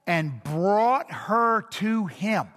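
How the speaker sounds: each word slowed and pressed; slow emphatic quote. Shown as a demonstration. Preacher to congregation, slow pointed scripture quote. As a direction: draw out the phrase to make them notice. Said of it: "And notice".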